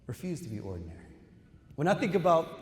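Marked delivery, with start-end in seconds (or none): 0.1-0.9 softer, lower repeat; quiet echo. Acted. Speaker repeating the call to graduates, softer and lower. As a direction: let it sink in gently.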